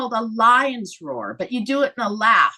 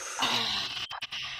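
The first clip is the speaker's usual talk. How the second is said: a deep, low sigh of release; long exhale sigh; 12 dB quieter than usual. Shown as a demonstration. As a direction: let all the air out with a long sigh. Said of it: "breathe them out".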